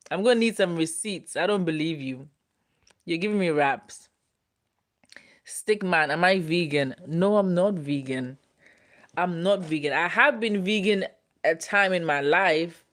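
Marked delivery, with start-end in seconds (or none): none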